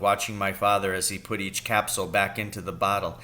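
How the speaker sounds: plain reading voice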